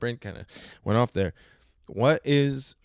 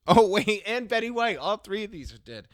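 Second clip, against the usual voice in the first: laughing surprise; 10 semitones higher, 5 dB louder than usual. Real, not acted. Laughing realization all dead.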